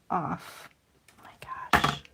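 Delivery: whispered dismay. Real, not acted. Crafter seeing the misaligned stamp, whispered. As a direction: hush it as the stamp lands off.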